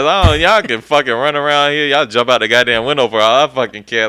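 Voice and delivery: loud, fed-up mock outburst; retold careless rant. Acted rant to class after firing.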